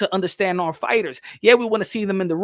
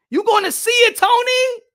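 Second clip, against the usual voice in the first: excited shout; 9 semitones higher than usual. Real, not acted. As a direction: hype Tony up. Hyping up the fighter.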